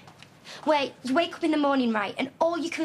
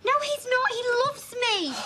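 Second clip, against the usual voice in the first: high-pitched, wailing denial; desperate protest; 10 semitones higher than usual. Acted. Daughter defending her boyfriend to mother, desperate high protest. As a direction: insist on it, pitch soaring.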